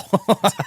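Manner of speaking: short high laugh; real laugh. Real, not acted. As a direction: laugh at the joke. Laughing at the joke.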